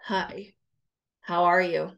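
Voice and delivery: low, curt, irritated hello; grudging hello, flat and low; annoyed greeting. Shown as a demonstration. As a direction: greet them like they're a bother. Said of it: "That kind of tone".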